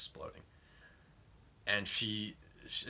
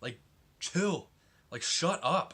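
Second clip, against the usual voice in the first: higher, louder, fed-up rant; exasperated outburst; 6 semitones higher, 5 dB louder than usual. Retold or imitated. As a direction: vent at the whiny kid, half yelling. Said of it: "and it's like".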